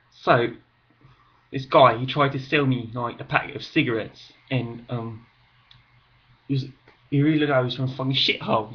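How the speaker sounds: put-on loud, brash Cockney accent. Vlogger telling a cigarette-seller story in a loud Cockney accent. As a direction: tell it like a loud Cockney bloke. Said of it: "it's Cockney".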